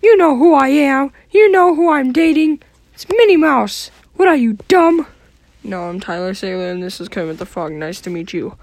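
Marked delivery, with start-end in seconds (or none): 0.0-5.1 high squeaky cartoon mouse; squeaky, snippy mouse voice; Mickey Mouse voice.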